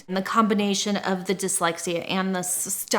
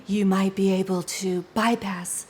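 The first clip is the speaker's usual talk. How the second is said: Marilyn Monroe voice. Shown as a demonstration. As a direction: slip into a hushed, airy screen-siren whisper. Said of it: "'Cause if you talk like this".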